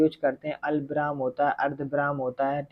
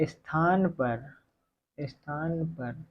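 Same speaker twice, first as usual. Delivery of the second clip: half-speed, repeated phrase trailing softer; slow dictation; 5 dB quieter, much slower than usual. Real, not acted. Teacher repeats a phrase while writing, slow dictation. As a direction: stretch each word for note-takers.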